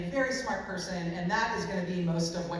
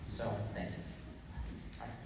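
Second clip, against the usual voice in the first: normal talking voice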